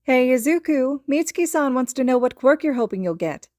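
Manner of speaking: mother calling to her son in the back seat; light voice. Acted. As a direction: call brightly over your shoulder to your boy. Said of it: "calls back to her son in a light voice".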